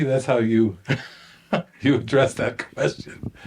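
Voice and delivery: ordinary delivery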